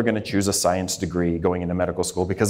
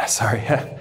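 a choked-up, whispered sorry through a small laugh; fighting back tears, half laughing. Real, not acted. Recalling his dad at the track meet, choked up. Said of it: "didn't expect to get emotional".

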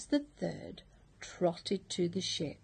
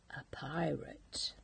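whispered big reveal; a secretive whisper; hushed reveal; 4 semitones lower, 10 dB quieter than usual. A narrator's reading. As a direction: drop to a whisper for the surprise. Storyteller's dramatic reveal.

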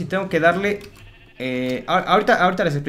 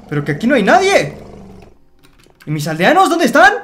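much higher, much louder shocked yell; shocked shout; 7 semitones higher, 8 dB louder than usual. Real, not acted. Gamer finds his villagers vanished, shocked high yell. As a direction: shriek in disbelief that they're gone.

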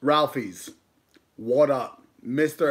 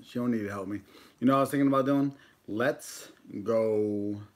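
ordinary talk

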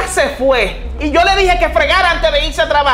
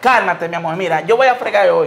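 lower, placating "calm down, love"; soothing; 7 semitones lower than usual. Acted. Husband backing down to calm the angry woman, soothing. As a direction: back down and calm her gently.

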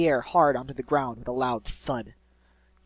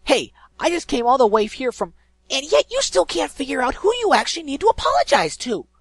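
angry; 12 semitones higher, 10 dB louder than usual. Acted. Dash angry at Gilda.

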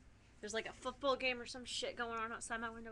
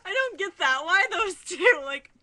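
louder, higher, giggling through it; giggly loud disbelief; 7 semitones higher, 12 dB louder than usual. Real, not acted. Fan comment amuses her.

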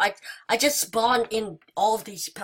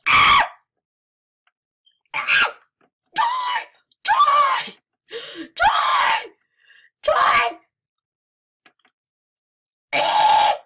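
very high loud screaming; high-pitched shrieks; 6 dB louder, much slower than usual. Real, not acted. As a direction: scream it at the top of your voice. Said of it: "that's hurting my voice".

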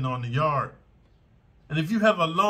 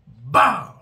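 sharp shouted "Bow!" for the kick; loud impact shout; 2 semitones lower, 10 dB louder than usual. Shown as a demonstration. Demonstrating a kick to viewers, sharp loud "Bow!". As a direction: bark the hit sound.

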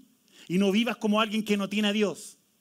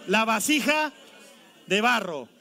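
preacher shout; 4 semitones higher, 5 dB louder than usual. Acted.

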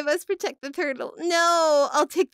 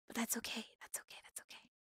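soft whispered reassurance; hushed playful comfort; 4 semitones lower, 20 dB quieter, faster than usual. Pretend. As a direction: breathe it out, barely voiced. Soothing chat, playful secrecy.

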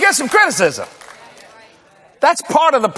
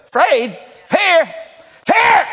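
mock disbelief; 6 semitones higher, much slower than usual. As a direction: build the incredulity word by word. Incredulous repetition of the word fear.

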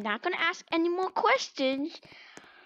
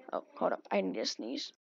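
whispered; 12 dB quieter than usual. Real, not acted. About to sneeze.

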